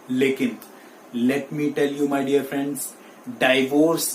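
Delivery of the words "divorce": 'divorce' starting dai, dai-vors; first syllable of 'divorce' like 'die'; wrong pronunciation. Shown as a demonstration. Teacher warning dear friends, wrong pronunciation dai-vors. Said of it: "is the wrong pronunciation".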